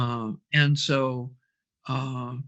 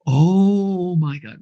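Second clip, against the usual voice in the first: amazed realization; 3 semitones higher, 5 dB louder, much slower than usual. Retold or imitated. Realization after revelation.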